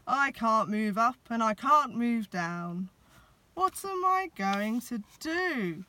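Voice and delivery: loud, rising worried Santa; stuck Santa, panicking. A narrator's reading. Santa stuck in the chimney, loud and flustered. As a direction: call out in a fluster, stuck fast. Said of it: "said a growly voice".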